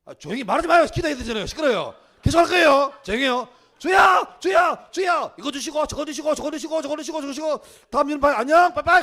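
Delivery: 높고 아주 크게 쏟아내는 고함; 대답은 안 듣고 요구만 외치듯; 막무가내 통성기도. Acted. 일방적으로 떠드는 기도 연기. (과장되게 떠들썩한 기도 흉내)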